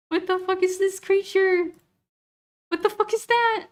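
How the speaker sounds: high-pitched, incredulous reaction; baffled shock. Real, not acted. Streamer spots a strange creature in the pictures, baffled shock. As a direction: voice jumps way up in disbelief.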